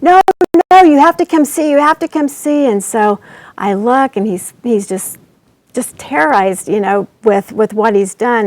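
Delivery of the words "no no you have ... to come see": put-on higher, louder urgent child's plea; retold son urgently pleading with his mother; anxious child pleading. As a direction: beg Mom to come look, frightened. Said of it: "he was flushed with anxiety".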